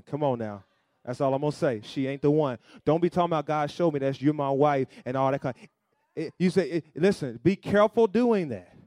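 loud sermon warning to the congregation; preaching at full force. Acted. Pastor warning single men about married women, preaching loud. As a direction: press the warning home, full voice.

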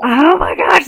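loud amazed exclamation. Real, not acted. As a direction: blurt it out in surprise. Reacting to two weeks.

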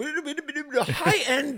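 high, laughing put-on voice; a hammed-up snob saying it, half laughing; mock snooty quote. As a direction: chirp it high through a laugh. Quoting character, laughing.

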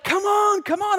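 high urgent call, come on come on; urgent shout. Retold or imitated. Guide shouting to a stuck climber, high and urgent. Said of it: "He's like".

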